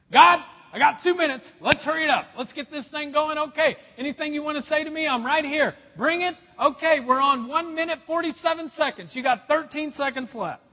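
loud, high, rushed mock prayer; frantic. Preacher mimicking someone giving God two minutes, frantic. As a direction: rattle it off frantically, as if against the clock. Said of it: "I mean, that's frantic".

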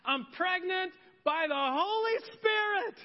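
high, loud, thrilled shout of good news; excited Mary. Acted. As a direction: burst out with the big news. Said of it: "She's like, ... she's excited".

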